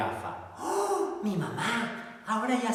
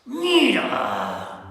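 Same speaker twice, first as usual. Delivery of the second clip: growled; 5 semitones higher, 8 dB louder than usual. Acted. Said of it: "gruñó la señora Morsa".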